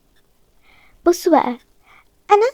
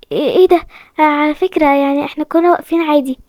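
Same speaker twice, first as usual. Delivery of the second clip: ordinary talk, nothing marked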